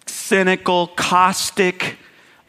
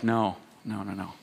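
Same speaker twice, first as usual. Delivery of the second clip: soft, much lower and quieter reassurance; tender voice of the Lord; 9 semitones lower, 10 dB quieter than usual. Retold or imitated. The Lord reassuring a perfectionist, soft and much lower. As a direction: gently correct a worried child. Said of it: "And the Lord's like".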